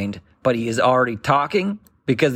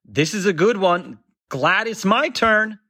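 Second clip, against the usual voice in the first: mock cheerful dad; 6 semitones higher than usual. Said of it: "Never ever, ever been like".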